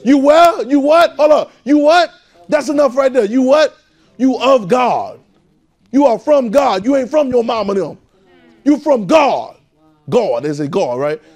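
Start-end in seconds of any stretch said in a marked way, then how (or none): 0.0-9.6 high, loud, rising preacher's call; full-force preaching. Real, not acted. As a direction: preach it hard to the room.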